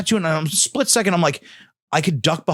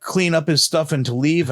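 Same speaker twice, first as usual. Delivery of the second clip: ordinary talk, nothing marked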